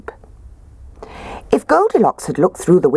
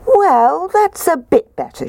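high voice; 13 semitones higher, 3 dB louder than usual. Acted. Goldilocks trying medium chair.

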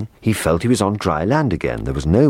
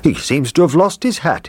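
lively, high-pitched cook; high, animated storybook character; cook's voice; 12 semitones higher, 3 dB louder than usual. A narrator's reading.

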